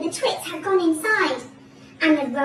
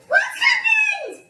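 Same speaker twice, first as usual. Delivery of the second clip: high, long drawn-out wail; screamed; 4 dB louder, much slower than usual. A narrator's reading. Missus Twit seeing the upturned room, screamed. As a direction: scream it slowly in horror. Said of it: "screamed Missus Twit".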